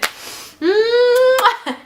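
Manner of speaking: a high, loud tearful laugh that cracks toward crying; real laugh-cry, half sob and half giggle; laughing through tears. Real, not acted. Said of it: "I don't even wanna cry. I'm gonna just laugh".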